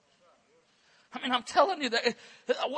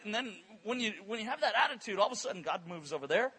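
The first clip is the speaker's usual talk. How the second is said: ordinary talk, nothing marked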